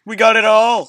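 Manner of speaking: loud, high triumphant yell; excited shout. Real, not acted. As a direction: shout it out proudly.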